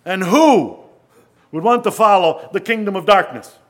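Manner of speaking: loud, high rhetorical question; preaching. Acted. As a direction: press the crowd with a booming challenge.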